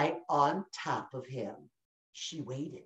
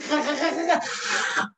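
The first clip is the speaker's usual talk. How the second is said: gloating monster laugh; 6 semitones higher than usual. Acted. Monster drinks the stew.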